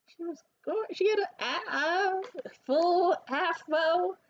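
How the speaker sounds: high, very slow, dreamy gush; swooning. Real, not acted. Describing the woman's afro, high slow swoon. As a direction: linger on it, starstruck. Swooning over her looks.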